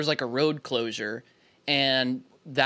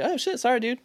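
light, higher-pitched mock apology; retold casual apology; 8 semitones higher than usual. Philly pedestrian unbothered by rude guy, light retold apology. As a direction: imitate a quick harmless sorry. Retells own mild apology.

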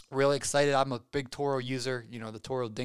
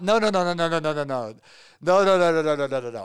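loud, emphatic protest; 3 semitones higher, 8 dB louder than usual. Real, not acted. Denying the coffee date is the girl.

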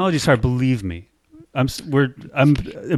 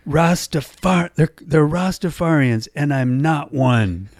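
put-on slow, higher, indignant drunk protest; drunken indignation; 5 semitones higher, slower than usual. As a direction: protest like an offended drunk, dragging the words. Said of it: "in my drunken indignation, I go".

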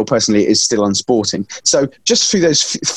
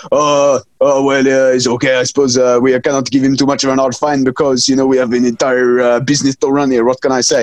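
French official shrugging it off in a mock accent; bad French accent. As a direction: put on your worst French accent. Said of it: "most offensive accent".

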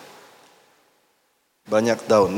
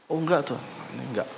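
dismissive quick brush-off; 9 dB quieter, much faster than usual. Retold or imitated. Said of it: "saya orang yang paling sombong".

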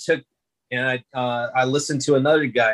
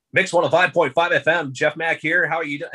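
bright radio-host patter, a bit higher; 5 semitones higher than usual. Retold or imitated. Announcing his station on air, radio DJ read. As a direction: sell it like a morning DJ. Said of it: "God, I sound like a radio guy now".

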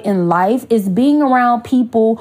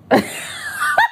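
airy laugh; 4 dB quieter than usual. Real, not acted. Laughing at the newcomers' reaction.

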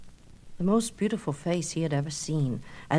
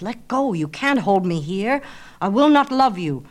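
angry rejection; 2 semitones higher, 6 dB louder than usual. Acted. Narcissus breaking from Echo's grip, loud and angry. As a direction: throw her off, loud.

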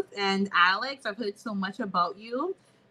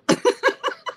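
rueful laugh; 6 dB louder than usual. Real, not acted. Guest laughs at lost funding.

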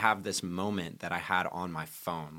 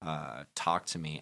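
usual speaking voice

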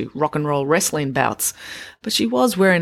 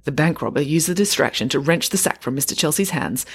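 ordinary delivery, nothing marked

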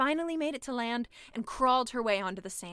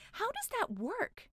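nervous question; 10 semitones higher, 3 dB quieter, slower than usual. Acted. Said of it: "asked the mermaid".

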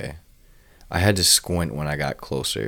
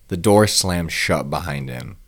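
ordinary delivery, nothing marked

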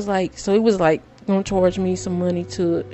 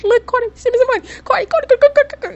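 much higher, louder, fast Cardi chant; excited shriek; 6 dB louder, much faster than usual. Retold or imitated. Mom shrieking at Cardi to send money, much higher. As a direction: shriek her name begging for money. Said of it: "screaming and shouting".

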